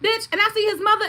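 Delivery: normal talking voice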